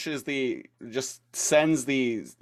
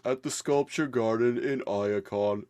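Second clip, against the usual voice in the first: dreamy voice; 4 semitones higher than usual. Retold or imitated. Voicing artist character.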